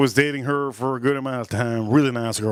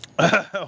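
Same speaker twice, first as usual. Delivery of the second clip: hearty laugh; 4 semitones higher than usual. Real, not acted. Laugh after the story.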